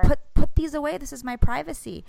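imitating a mom's urgent reprimand; mildly flustered scolding. Mom telling son off at the register, flustered scolding.

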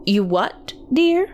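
lightly voiced aunt, questioning lilt; puzzled aunt. A narrator's reading. Aunt asks the worker, puzzled. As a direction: pitch jumping up on "dear". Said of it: "she asked".

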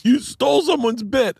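high-pitched, drawn-out accusing quote; mock accusation. Acted accusation from online critic.

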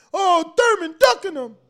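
high, loud mimicry of outraged fans; exaggerated high-pitched accusation; mock fan outcry. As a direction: cry it out like an indignant fan, mockingly. Mimics fans' biased outcry.

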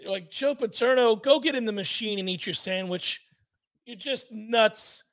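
usual speaking voice

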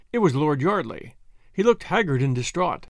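ordinary delivery

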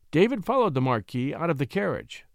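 ordinary delivery

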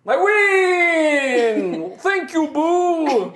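high, loud gleeful boast; delighted brute cheering; barbarian. Acted. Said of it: "Minsk is just like".

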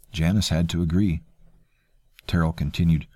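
plain reading voice

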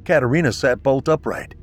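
usual speaking voice

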